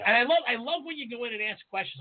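normal talking voice

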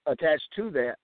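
normal talking voice

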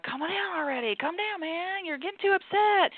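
high, mocking, dismissive brush-off; dismissive calm down. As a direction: wave off their upset with a high, condescending tone. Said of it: "and you're like".

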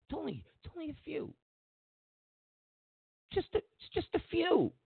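high, soft, fast mocking tone. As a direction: squeak it out like a sneering pundit. Mocking the media's excuse.

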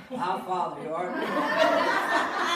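loud, drawn-out group prayer, hammed up; everybody praying. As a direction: lead the whole bar in a big, slow recitation.

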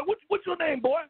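ordinary talk, nothing marked

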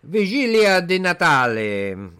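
loud, high, slow hammed-up Italian; Italian accent. Co-host performs the holiday's Italian name on request, hammed-up Italian accent. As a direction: stretch it out in a big Italian voice. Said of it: "say this in your Italian voice".

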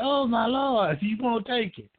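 mock exasperation through a laugh; laughing exclamation. Caller retelling the father refusing his gift, laughing disbelief.

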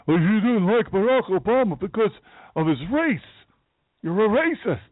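high mocking whiny accuser voice. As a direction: sneer it like a smug critic.

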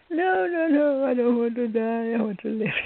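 high mock wail, easing down; hammed-up imitation of her desperate plea; theatrical pleading. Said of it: "make such a spectac".

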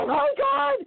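very high, loud oh my God; mortified actor jumping off his co-star, panicked shriek; panicked cry. Retold or imitated. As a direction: blurt it as he bolts. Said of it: "he took off running".

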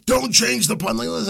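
high, loud pleading listener voice; mock listener plea. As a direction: play a fan begging you not to change. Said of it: "I gotta say that like that".

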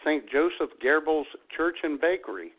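usual speaking voice

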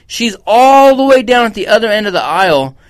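ordinary talk, nothing marked

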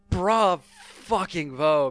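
slow emphatic bra fucking vo; drawn-out bravo. Real, not acted. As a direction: stretch out bra fucking vo syllable by syllable.

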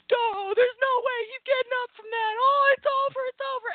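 dopey fan. Retold or imitated. Imitating a fan during the match, goofy high voice. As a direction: play a dim fan sure it's over.

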